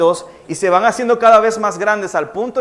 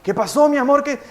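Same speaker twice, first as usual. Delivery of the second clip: alarmed fatherly question; 7 semitones higher than usual. Retold or imitated. Father alarmed by crying son.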